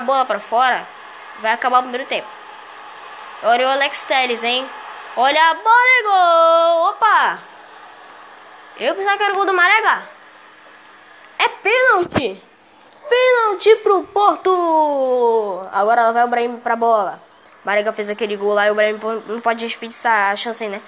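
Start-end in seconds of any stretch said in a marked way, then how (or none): 5.2-15.7 stretched excited yell; explosive goal commentary. Real, not acted. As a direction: shout the penalty call, drawn out. Penalty call in commentary.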